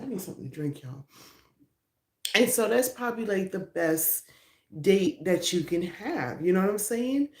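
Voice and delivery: ordinary talk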